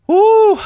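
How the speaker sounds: loud, high woo; excited whoop. Real, not acted. Reacting excitedly to new skin.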